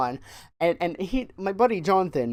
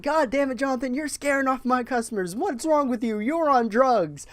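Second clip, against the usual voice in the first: higher-pitched pretend telling-off; mock scolding; 8 semitones higher than usual. Clerk messing with his buddy about customers, mock scolding. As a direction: scold him as a joke, pitched up. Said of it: "messing with Jonathan".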